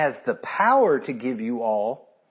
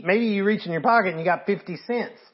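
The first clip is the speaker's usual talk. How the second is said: ordinary talk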